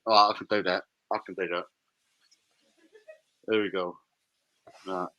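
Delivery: a hammed-up deep movie-trailer voice; deep put-on voice. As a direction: drop the voice low and gruff, mock announcer. Mate reads can in put-on deep voice.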